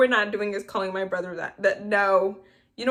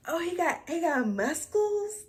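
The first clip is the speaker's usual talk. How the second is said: mock swooning girl; 9 semitones higher than usual. Vlogger mocks Paget drooling over Cameron, in a higher swooning voice. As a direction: put on a dreamy teen crush voice.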